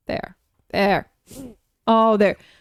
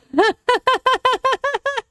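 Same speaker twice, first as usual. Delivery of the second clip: high giggle; 12 semitones higher than usual. Real, not acted. Laughs at cuteness.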